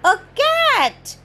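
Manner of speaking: put-on lively storybook reading for little kids; story narration. Presenter reading a picture book to children, animated storytelling. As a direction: read it out like story time, big and bright.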